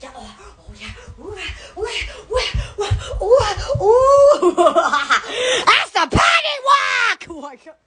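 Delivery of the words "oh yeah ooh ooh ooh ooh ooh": normal talking voice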